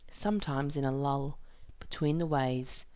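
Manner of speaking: ordinary delivery, nothing marked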